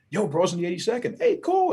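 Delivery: a hint of an excited fan greeting a veteran; mock fan. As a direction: imitate an impressed guy, a little hammed-up. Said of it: "people be like".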